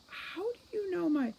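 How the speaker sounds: high, sudden alarmed cry; startled. Acted. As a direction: blurt it out in shock. Holly startled, cut off.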